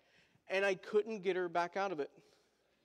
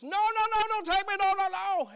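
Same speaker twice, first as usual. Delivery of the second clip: high, loud mock tantrum scream of a little girl; toddler's panicked cry; 12 semitones higher, 8 dB louder than usual. Daughter resisting dad at aquarium, high panicked mock scream. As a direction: wail like a child being dragged off.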